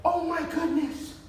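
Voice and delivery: very high and slow, drawn-out gush; drawn-out high praise; excited. Shown as a demonstration. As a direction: stretch it out in delight. High-energy praise for the dog.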